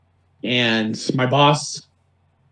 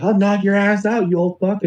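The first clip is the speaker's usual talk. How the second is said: retold screamed threat; 7 semitones higher than usual. Homeless man threatening the manager, mock screamed rant. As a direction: imitate a ranting man making threats. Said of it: "just screaming all these things, threatening him".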